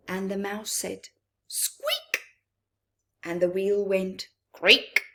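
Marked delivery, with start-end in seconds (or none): 1.5-2.2 very high sharp mouse squeak; tiny shrill mouse cry. Acted. 4.5-5.0 louder punchy creak; loud sudden creaking noise. Acted. Wheel creaks in alarm.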